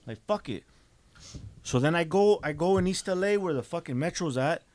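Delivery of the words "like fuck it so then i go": ordinary delivery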